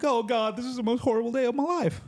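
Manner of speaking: high, rushed mock complainer; hammed-up wail of a pampered complainer; put-on whiny voice. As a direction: squeal it fast in a silly high voice. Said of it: "I don't know what that accent or voice was".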